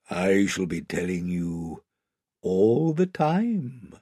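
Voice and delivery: low, measured, softened lion voice; Aslan, solemn lion. Acted. Great lion answering the children, low and solemn. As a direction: speak with quiet, slow authority.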